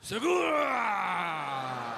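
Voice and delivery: hugely stretched, shouted mock Japanese cry; mock Japanese accent. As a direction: bellow "Segura" in a hammed-up Japanese accent, dragging the last vowel. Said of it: "you're saying it Japanese".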